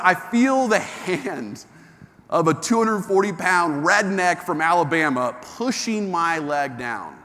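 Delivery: slow, deliberate suspense; slow dramatic telling. Acted. Speaker building suspense for the audience, slowly. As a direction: stretch it out for suspense.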